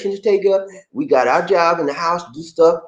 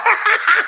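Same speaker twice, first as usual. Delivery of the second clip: high-pitched burst of laughter; sudden high giggling laugh. Real, not acted. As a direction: break into a high cackle. Laughs after wannabe line.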